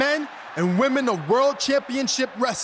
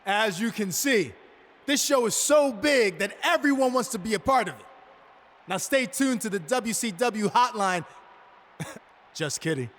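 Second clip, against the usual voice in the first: usual speaking voice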